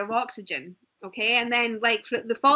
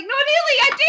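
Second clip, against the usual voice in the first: giggling insistence; 7 dB louder, faster than usual. Retold or imitated. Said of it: "And I was like".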